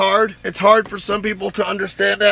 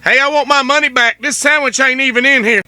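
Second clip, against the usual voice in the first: hammed-up indignant demand, higher and louder; mock complaint; 7 semitones higher, 4 dB louder than usual. Teasing the sandwich worker with a mock outraged demand. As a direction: play the outraged customer as a joke.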